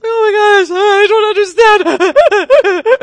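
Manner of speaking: very high, loud, slow crying voice; hammed-up sobbing to a friend; mock wailing. Crying to her girlfriends.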